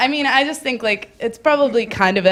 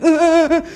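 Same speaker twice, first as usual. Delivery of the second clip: high-pitched imitated sob; hammed-up whimper; mock crying wail; 10 semitones higher, 4 dB louder than usual. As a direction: wail like a drunk-party crier. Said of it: "somebody's crying at the end".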